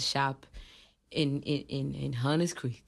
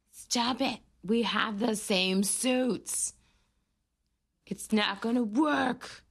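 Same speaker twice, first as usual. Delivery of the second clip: higher, slow, hammed-up character; mock character voice; 5 semitones higher, slower than usual. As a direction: play it as a silly movie hero. Voicing characters on screen.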